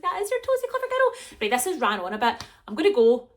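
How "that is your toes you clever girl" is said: high, loud sing-song praise; praising a toddler. Retold or imitated. Mum praising her toddler daughter, high and gushing. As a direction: gush over her like a proud mum. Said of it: "I'm like".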